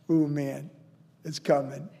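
ordinary talk

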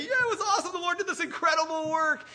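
very high, loud elated cheer; jumping-for-joy excitement. Acted. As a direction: shout it as if jumping up and down. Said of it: "want to jump up and down and say".

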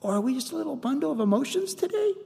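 high, soft cooing voice; Saint Miss Judy. Retold or imitated. Front-office woman at a pre-K to little children, high soft coo. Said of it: "Miss Judy, who works in the front office".

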